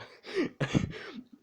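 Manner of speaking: high-pitched laugh; real laugh. Real, not acted. Storyteller amused at the spirit gun line, real laugh.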